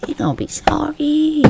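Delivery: a soft, high mock threat; high teasing singsong. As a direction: sing it up high like a tease. Teasing warning, said twice.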